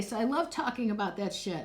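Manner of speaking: ordinary delivery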